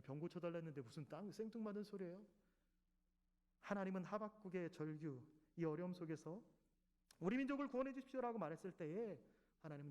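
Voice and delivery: normal talking voice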